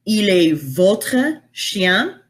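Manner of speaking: "Il est votre chien" slow and careful in French; slow French line. Shown as a demonstration. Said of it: "But in French".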